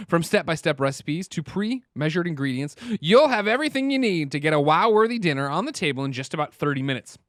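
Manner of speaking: upbeat sponsor read. Acted. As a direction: read it like a podcast ad. Reads a sponsor ad.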